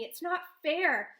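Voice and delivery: angry outburst. A narrator's reading. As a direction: let the unfairness burst out. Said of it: "Anger burns through me".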